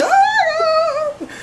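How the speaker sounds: high, loud, drawn-out wail; imitating a tearful fangirl meltdown; mock crying. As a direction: wail it out like an overwhelmed fan. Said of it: "Like, I start crying".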